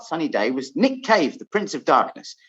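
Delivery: usual speaking voice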